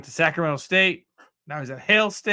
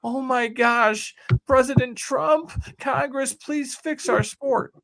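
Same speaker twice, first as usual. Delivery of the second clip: high, slow, whining mock begging; mock plea; 7 semitones higher, slower than usual. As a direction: parody someone pleading with the President. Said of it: "like, trying to say".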